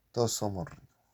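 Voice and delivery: a light quoted-character voice, slightly off his own; Pato Chico's voice. Retold or imitated. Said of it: "dijo el Pato Chico". (cita con voz ligera de personaje)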